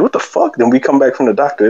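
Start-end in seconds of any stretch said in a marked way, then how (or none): 0.0-0.5 squeaky, disbelieving yelp; high shocked cry. Retold or imitated. Injured guy's high cry of disbelief. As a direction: act out the painful shock, high. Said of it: "And I'm like".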